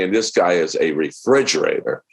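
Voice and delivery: ordinary talk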